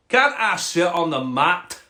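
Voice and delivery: slow, pleading Scottish frog. Acted. Frog asking the cat for the mat, Scottish accent. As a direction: ask as a Scottish frog, drawn out. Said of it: "a Scottish frog".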